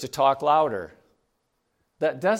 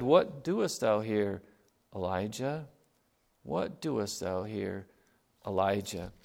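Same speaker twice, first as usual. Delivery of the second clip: slow, softer and lower question; God gently asking Elijah, slow and soft; still small voice; 4 semitones lower, 6 dB quieter than usual. Acted. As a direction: ask it gently and slowly. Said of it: "A still small voice".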